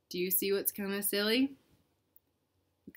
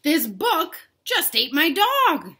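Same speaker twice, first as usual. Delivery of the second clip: slow, louder, disbelieving complaint; stunned Bella; 7 semitones higher, 9 dB louder, slower than usual. Acted. Bella telling Ben the book ate her dog. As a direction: spell out the shock word by word.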